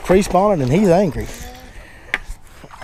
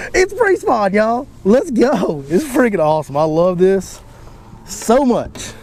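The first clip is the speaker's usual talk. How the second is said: thrilled; 6 semitones higher, slower than usual. Real, not acted. Thrilled after big catch.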